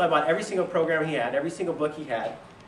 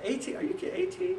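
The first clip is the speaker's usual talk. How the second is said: shocked disbelief; 5 dB quieter than usual. Retold or imitated. Mentor stunned by his age.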